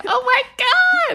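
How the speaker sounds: high, loud mock outcry; hammed-up exclamation. Co-host balks at the earl's long name, hammed-up outcry.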